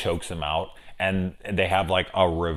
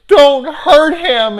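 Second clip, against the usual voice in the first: high, loud, slow mock shriek; 13 dB louder, much slower than usual. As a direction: shriek it like the hysterical girlfriend. Woman at bar shrieking.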